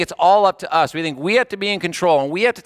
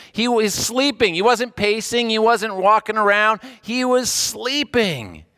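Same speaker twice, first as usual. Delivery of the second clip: high, insistent stress on sleeping and wasn't; preacher pounding the contrast; emphatic repetition; 6 semitones higher than usual. Acted. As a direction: hammer each short line home. Preacher stressing Jesus asleep.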